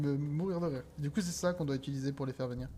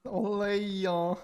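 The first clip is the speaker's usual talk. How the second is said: Parisian French accent; 4 semitones higher, much slower than usual. Shown as a demonstration. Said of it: "avec l'accent parisien".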